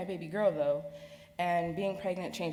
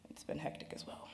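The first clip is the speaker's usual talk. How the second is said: breathy whispered aside, almost no voice; 2 semitones lower, 13 dB quieter than usual. Real, not acted.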